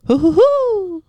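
high squeaky Mario hoo hoo hoo; Mario's hoo. Retold or imitated. Asking friends, doing Mario's high hoo. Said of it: "isn't he like".

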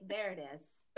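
imitated hushed, knowing remark; quiet satisfaction. Man finally hearing y'all, imitated quiet satisfaction.

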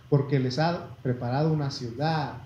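ordinary talk

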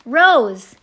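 long, very loud holler; drawn-out shout, falling at the end. A narrator's reading. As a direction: holler the name out into the dark. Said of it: "I hollered into the darkness".

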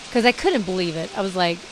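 ordinary delivery

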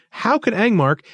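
high, incredulous objection; protesting. A narrator's reading. Thistle doubting that it was Angmark, protesting. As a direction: protest with disbelief. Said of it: "Thistle protested".

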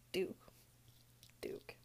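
hushed whisper of the name. Real, not acted. Whispering to the camera about Duke. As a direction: drop to a breathy whisper.

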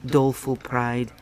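ordinary delivery

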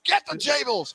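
high shouted call to bring the tables; shouted order. Retold or imitated. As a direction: yell it across the tailgate, high. Voicing the tailgate leader calling.

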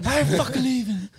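high, slow hammed-up movie line; DiCaprio impression. Cohost echoing the DiCaprio quote, slow high mock. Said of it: "shout out Leonardo DiCaprio".

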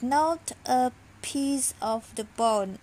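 usual speaking voice

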